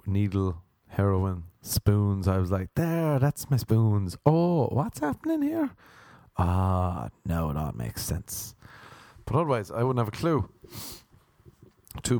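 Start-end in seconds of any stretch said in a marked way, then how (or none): 2.7-5.7 very high, innocent question; mock surprise, pitch shooting up; naive surprise. Acted discovery of passed-out person. 6.4-8.6 low, knowing drop in voice; mock-wise, softening low; dawning realisation. Acted realisation.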